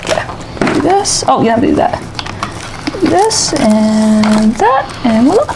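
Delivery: ordinary talk, nothing marked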